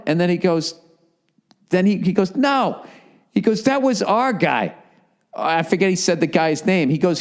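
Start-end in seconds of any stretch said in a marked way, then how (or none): 2.4-2.8 high-pitched, imitated wounded refusal; hurt denial. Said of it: "how, like, hurt he was". 3.6-4.7 slow, higher-pitched wounded protest; hurt, defensive. Retold or imitated. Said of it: "how, like, hurt he was".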